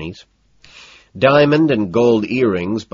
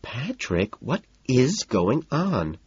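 high, slow, softer complaint; whiny protest; 5 semitones higher, 3 dB quieter, much slower than usual. A narrator's reading. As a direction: demand an answer in a drawn-out whine. Evelyn protests being dragged away.

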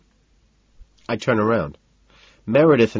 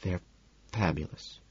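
hushed aside to one listener; confiding aside; 12 dB quieter than usual. A narrator's reading. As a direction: confide it under the party chatter. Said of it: "confides to me as an aside".

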